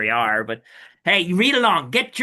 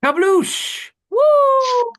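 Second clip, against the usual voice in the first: excited high shout; 7 dB louder, much slower than usual. Acted. Sound effect shouting.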